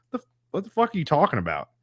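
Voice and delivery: high, quick retold disbelief; imitating a dumbfounded comeback; mock incredulous. Quotes own reaction to dumb questions.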